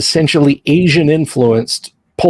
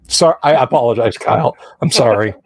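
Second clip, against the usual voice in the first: normal talking voice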